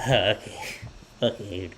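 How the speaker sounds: soft, low, pleased acceptance; meekly happy mom. Retold or imitated. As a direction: melt quietly, imitating a charmed mom. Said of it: "She's just so happy".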